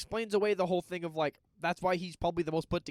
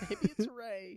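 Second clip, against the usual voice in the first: laughing; 4 semitones higher, 4 dB quieter, slower than usual. Real, not acted. Laughing repeat of a joke.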